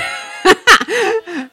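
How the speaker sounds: helpless high, loud laugh; real burst of laughter. Real, not acted. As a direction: crack up loudly. Laughing at offending own listeners.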